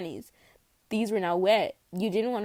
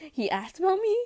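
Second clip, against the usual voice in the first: much higher, startled question; surprised; 11 semitones higher than usual. A narrator's reading. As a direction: catch the surprise in the pitch. Surprised Ushijima asked about her.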